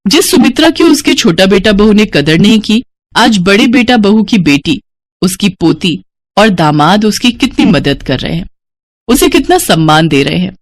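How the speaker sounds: plain reading voice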